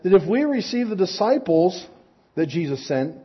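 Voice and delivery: ordinary talk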